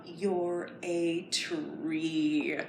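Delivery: whispered, very slow "you're a tree". Shown as a demonstration. Teacher whispering to children stretching like trees, slow. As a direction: hush it out long and calm. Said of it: "Stretch your arms up".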